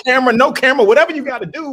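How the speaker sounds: high, loud fired-up pep talk; rally him like a coach; rallying shout. Retold or imitated. Co-host echoing the host's loud rallying speech.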